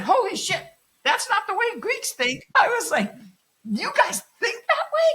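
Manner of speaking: high, loud incredulous outburst; shocked disbelief. Retold or imitated. Teacher reacts to a mother's forgiving advice with shock. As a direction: blurt it out in total disbelief.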